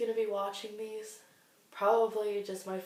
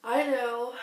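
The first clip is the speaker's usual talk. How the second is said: tearful; 3 semitones higher, 6 dB louder, much slower than usual. Real, not acted. Gathering herself after crying.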